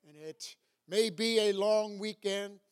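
ordinary delivery

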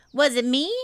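put-on high, loud childlike question; a little surprised. A narrator's reading. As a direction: innocent small child taken aback. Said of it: "a little surprised".

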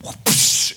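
voiceless swung-shovel noise; airy mouth sound effect; imitated shovel whoosh. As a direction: make the whoosh of a swing. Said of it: "he went".

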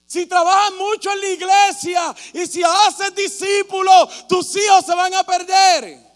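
high, loud, slow hammed-up rebuke; scolding shout. Preacher imitating Christians shouting back at him, loud and slow. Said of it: "es como si me gritaran".

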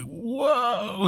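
long, high-pitched wonder; softly stretched high gasp of amazement; mock awestruck. Tripping Eve sees all the planets, high and awestruck.

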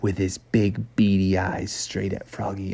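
hushed storyteller whisper; bedtime-story narrator whispering the scary part; suspense whisper. A narrator's reading. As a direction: drop to a whisper to build suspense. Suspense as gator stares.

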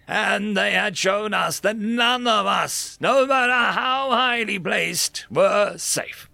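solemn, rising dramatic address; grave alien speaker. Acted. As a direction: speak like a defeated alien general. Narrating an alien's grave speech.

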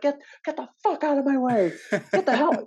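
high, loud mock yell of an exasperated performer; imitated yelling. Actress yelling at extras in her way, imitated high-pitched yell. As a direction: yell it, mimicking a furious co-star. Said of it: "you can hear her yelling".

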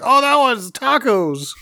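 high, drawn-out French guy cry; mock French guy. French guy hit by taco cake, mock dismay. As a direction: wail it slowly as a silly French guy. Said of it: "one of the French guys, like".

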